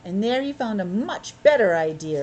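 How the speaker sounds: steady read-aloud storytelling voice; story narration. Acted. Parent reading Paddington to a child, storytelling voice.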